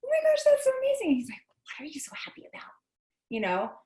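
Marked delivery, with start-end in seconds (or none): 0.0-1.2 high, fast gushing delight; imitating a thrilled phone chat; gushing, smiling. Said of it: "just totally smiling". 1.5-2.7 annoyed near-whisper, much softer; imitated irritation, hushed. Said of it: "kind of annoyed with me".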